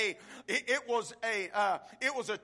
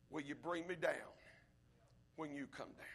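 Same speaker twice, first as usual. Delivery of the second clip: quiet plea; 8 semitones lower, 14 dB quieter than usual. Acted. Preacher voicing thief, dropped voice.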